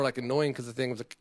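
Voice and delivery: normal talking voice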